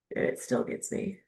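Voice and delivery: a moved, private whisper; tearful whisper. Real, not acted. Touched by the book after ten years, whispering. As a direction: breathe it out, voice gone. Repeats line, then whispers it.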